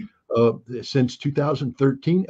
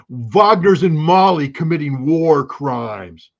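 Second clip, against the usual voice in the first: very slow, drawn-out dumb American parroting; dumb American accent; 4 dB louder, much slower than usual. Acted. Cutting in as a critic who won't listen, dumb American accent. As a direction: drone the slogan like a dumb American. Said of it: "my worst dumb American accent".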